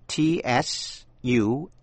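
usual speaking voice

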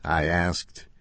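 ordinary delivery, nothing marked

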